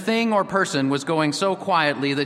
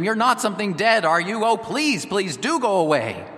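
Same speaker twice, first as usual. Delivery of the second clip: frightened plea, pitched much higher; near scream; 8 semitones higher than usual. A narrator's reading. Lost boy begs the unseen thing to leave, near scream. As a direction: plead in alarm, almost screaming but held back. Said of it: "Then he said almost in a scream".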